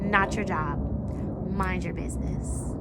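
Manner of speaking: flat, firm brother voice setting her straight; lightly imitating her brother shutting her down; brother, deadpan and firm. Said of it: "looked me dead in my face and said".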